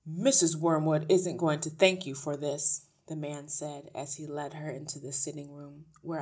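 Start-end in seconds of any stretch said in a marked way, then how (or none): 0.0-2.8 lightly voiced grumpy man, louder; a hint of a loud grumble; grudging father. A narrator's reading. Father warns teacher while leading her in, grudging.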